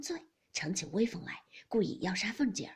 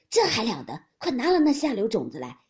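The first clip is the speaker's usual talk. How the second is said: outraged command; 8 dB louder than usual. A narrator's reading. Matriarch outraged, orders offender brought.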